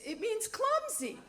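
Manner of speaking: high, sure-of-herself answer; proudly. Retold or imitated. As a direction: say it like you're proud to know. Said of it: "Proudly, she says".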